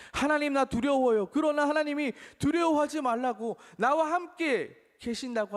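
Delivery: high, loud pleading cry; fearful cry. Acted. Believer crying out to God, high loud plea.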